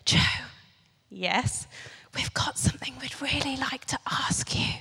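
hushed, secretive mock whisper; conspiratorial whisper. Dorm group of girls approaching their camp leader, conspiratorial whisper. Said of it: "came up to me sort of conspiratorially".

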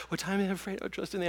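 fast, soft, panicked rattling of the verse; boy in bed reciting the verse, fast and soft; frightened rapid recitation. Retold or imitated. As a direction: gabble it under your breath like a scared kid. Terrified boy hearing sounds.

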